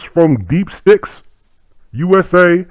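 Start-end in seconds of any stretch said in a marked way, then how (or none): none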